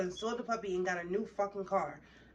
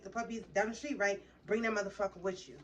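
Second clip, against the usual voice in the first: ordinary talk, nothing marked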